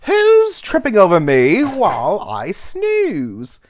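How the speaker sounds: put-on slow, loud, drawn-out old caterpillar; perplexed, amused caterpillar. Sleepy caterpillar woken by a stumble, perplexed and amused. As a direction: stretch it out, puzzled. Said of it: "perplexed and amused".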